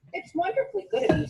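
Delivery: high quick mouse reply; a polite little mouse brushing off the snake; squeaky polite mouse. Acted.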